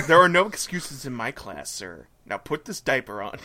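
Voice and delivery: low, quiet stern teacher. Acted. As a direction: lay down the law, deep.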